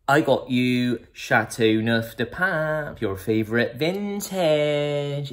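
slow, posh voice with Bristol showing through; posh put-on over Bristol accent. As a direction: put on airs but let the Bristol slip. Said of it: "you still hear his Bristol accent".

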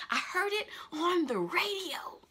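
whispered in disbelief; awed whisper. Real, not acted. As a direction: breathe it like a secret. Awed about hearing radio.

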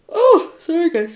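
talking through a yawn; high stretched yawn voice. Real, not acted. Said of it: "just yawning".

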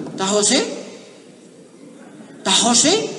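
put-on high, loud calling children; kids at the door. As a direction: call out eagerly at the door. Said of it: "empezaron a llegar los muchachitos".

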